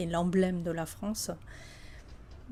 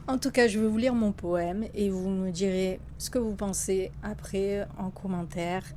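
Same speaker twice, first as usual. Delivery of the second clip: ordinary delivery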